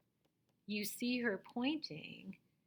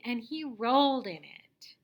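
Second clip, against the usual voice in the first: loud storybook narration; reading like a teacher; 3 semitones higher, 7 dB louder than usual. Shown as a demonstration. Teacher showing how to read the story, loud expressive read-aloud. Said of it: "read it like a teacher".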